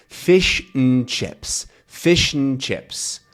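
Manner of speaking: slow "Fish and chips" with "and" as a bare n; syllabic n. Shown as a demonstration. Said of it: "the N is functioning".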